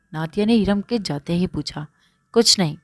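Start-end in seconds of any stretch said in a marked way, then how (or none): none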